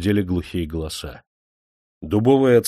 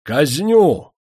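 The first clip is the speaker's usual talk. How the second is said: much higher, louder angry cry; furious vow; 9 semitones higher, 7 dB louder than usual. A narrator's reading. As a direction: spit out the vow. Master vows execution.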